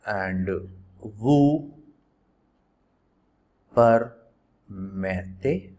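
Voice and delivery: "permettez" stretched out very slowly; drawn-out dictation. Shown as a demonstration. Teacher dictating the polite question, very slow. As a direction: draw out each syllable of "permettez". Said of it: "how would you write".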